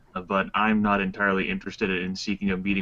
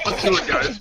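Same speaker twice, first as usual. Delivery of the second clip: speaking through laughter; laughing; 7 semitones higher, 6 dB louder than usual. Real, not acted. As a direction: cheer them on while laughing. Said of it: "laughing while speaking".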